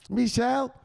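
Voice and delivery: high questioning call of her son's name; a mother calling down to her son. Retold or imitated. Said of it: "his mama asks him".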